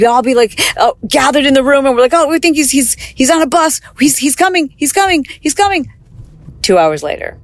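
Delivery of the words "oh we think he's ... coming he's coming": rising higher and higher with each "he's coming"; hopeful excitement. Retold or imitated. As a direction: retell the hopeful waiting, climbing in pitch. Hopeful family waiting for uncle.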